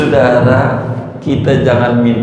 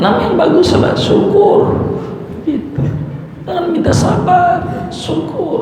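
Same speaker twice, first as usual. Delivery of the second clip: high exhortation. Real, not acted. Preacher urging the congregation to ask for gratitude, voice raised high. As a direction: jump much higher on "Jangan minta sabar".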